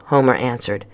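ordinary delivery, nothing marked

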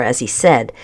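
ordinary delivery, nothing marked

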